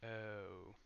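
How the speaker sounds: normal talking voice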